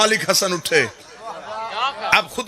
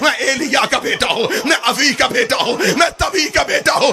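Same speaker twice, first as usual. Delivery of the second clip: climactic shout; 6 semitones higher than usual. Acted. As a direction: hurl it to the whole court. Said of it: "حسن کی آواز آئی". (پورے دربار سے بلند پکار)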